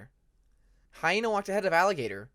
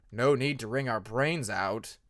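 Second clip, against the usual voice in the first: grumpy; 4 semitones lower, slower than usual. A narrator's reading. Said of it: "made his dislike of the noise well known".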